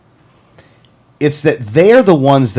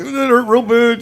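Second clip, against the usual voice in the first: pained whine; 10 semitones higher, slower than usual. Retold or imitated. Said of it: "I'm like".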